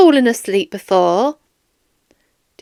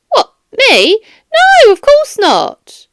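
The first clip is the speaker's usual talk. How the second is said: mock surprise; 10 semitones higher, 4 dB louder, slower than usual. Guard caught lying, feigns surprise.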